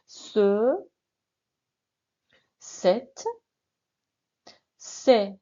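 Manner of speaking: ce, cette, c'est each said slowly and apart; drilled demonstratives. Shown as a demonstration. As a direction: model "Ce", "cette", "c'est" one by one for repeating. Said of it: "Répétez après moi".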